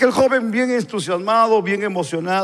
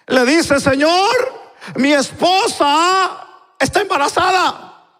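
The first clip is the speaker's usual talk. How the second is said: high, slow pleading with drawn-out endings; impassioned preaching; 8 semitones higher, slower than usual. Acted. Preacher voices a man begging God for delay, impassioned. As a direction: plead it in a raised preacher's cry.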